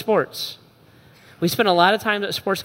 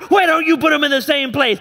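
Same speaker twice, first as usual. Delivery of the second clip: loud, higher fed-up shout; exasperated yell; 5 semitones higher, 3 dB louder than usual. Retold or imitated. Dad shouting at the kid about lost shin guards, loud exasperated yell.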